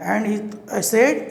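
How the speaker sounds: plain reading voice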